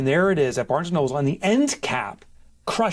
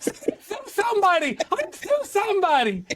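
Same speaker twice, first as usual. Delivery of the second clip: angry customer threat. Pretend. Man playing an angry woman customer threatening to sue, high huffy voice. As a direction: threaten a lawsuit like an outraged shopper.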